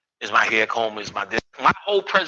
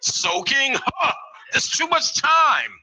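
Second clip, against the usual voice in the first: mock disbelief; 11 semitones higher, much slower than usual. Mocking disbelief about soaking.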